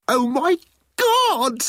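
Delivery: high excited shriek; excited squeal. A narrator's reading. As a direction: squeal with delight. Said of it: "said the computer excitedly".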